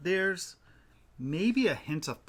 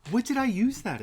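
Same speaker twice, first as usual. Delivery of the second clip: mock indignant; 10 semitones higher, 5 dB louder than usual. Real, not acted. Playful mock argument over yarn.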